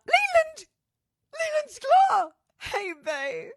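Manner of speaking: very high, loud call-out; excited shout. Real, not acted. Host greeting a musician friend online, high excited shout. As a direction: shriek the friend's name with delight.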